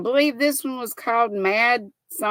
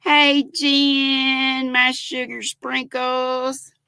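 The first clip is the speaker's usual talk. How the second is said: ordinary delivery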